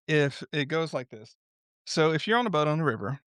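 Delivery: ordinary delivery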